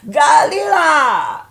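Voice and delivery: high, very drawn-out yell of "Galila"; mocking holler. Teasing the man with her friend's word, mocking holler. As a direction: stretch out a loud accented taunt. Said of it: "if I see him".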